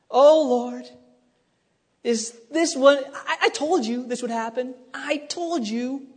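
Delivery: high, loud complaining prophet; whiny Jonah. Acted. Jonah complaining to the Lord, high whiny voice. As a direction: whine it up to God like a sulking teen.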